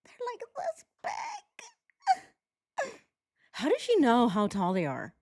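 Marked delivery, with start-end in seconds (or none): 0.1-2.9 slow, soft, very high fake whimper; mock crying. Commentator parodies her describing the children, mock tearful squeak. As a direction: squeak it through drawn-out fake tears. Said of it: "She's like".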